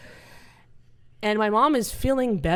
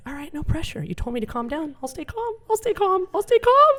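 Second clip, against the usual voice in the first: mock panic rising; 10 semitones higher than usual. Comic pretending to stay calm at the hospital, rising mock panic. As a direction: talk yourself calm while panicking more. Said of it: "I'm like".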